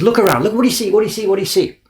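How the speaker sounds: high, loud, insistent questions; pressing. Retold or imitated. Papermaker pressing his guest to look, high and loud. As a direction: hammer the question home.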